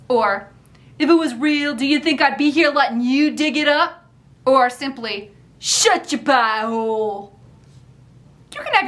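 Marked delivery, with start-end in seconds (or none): 1.0-4.0 put-on cranky old Philadelphia man; mock them in a mean Philadelphia accent. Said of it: "in a mean-sounding Philadelphia accent". 5.6-7.4 slow, cranky Philadelphia put-down; mean Philadelphia accent. Acted. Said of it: "in a mean-sounding Philadelphia accent".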